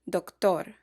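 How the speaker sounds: "doctor" said the Spanish way, stress on the last syllable "tor", trilled r; Spanish pronunciation. Shown as a demonstration. Teacher models a Spanish word for learners, Spanish pronunciation. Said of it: "are stressed on the last syllable".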